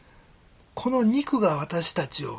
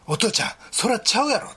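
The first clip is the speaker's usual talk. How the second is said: put-on child's blunt protest; 6 semitones higher, 3 dB louder than usual. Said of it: "と".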